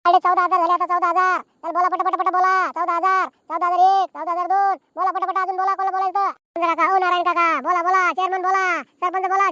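running auction call; auctioneer's patter. Acted. Auctioneer urging villagers to bid quickly, auction patter. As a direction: urge the crowd on, bid after bid. Calls bids and urges more.

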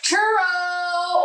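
excited call. Real, not acted. Calls out flavor name.

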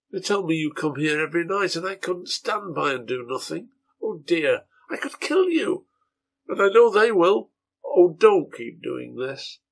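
a mother begging her son, near tears; heartbroken mother. Acted. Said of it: "her heartbreaking voice".

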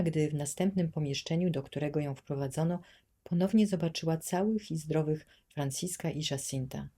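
ordinary delivery, nothing marked

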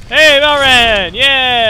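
loud, high drawn-out cheer; calling out. Real, not acted. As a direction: shout it high and long, easing by the end. Calls out to companion in fight.

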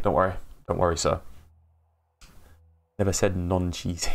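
usual speaking voice

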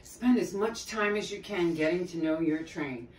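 ordinary delivery

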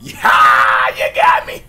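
scared yell. Real, not acted. Jump scare, screaming.

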